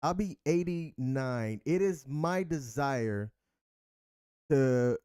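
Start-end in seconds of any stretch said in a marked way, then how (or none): none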